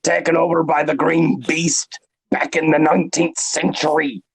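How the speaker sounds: hammed-up Scottish accent; put-on Scottish storyteller accent. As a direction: roll out a mock Scottish burr. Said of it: "That was a Scottish accent".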